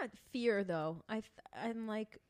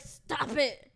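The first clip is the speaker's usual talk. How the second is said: annoyed; 6 semitones higher, 9 dB louder than usual. Retold or imitated. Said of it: "I got pissed".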